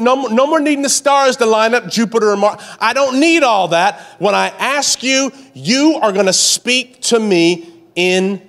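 high, loud, driving preacher cadence; full-force preaching. Acted. Preaching continues.

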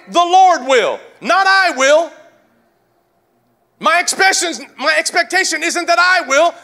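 high, loud, shouted proclamation; full-force preaching. Real, not acted. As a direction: build to a high-pitched battle cry from the pulpit.

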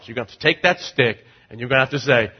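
usual speaking voice

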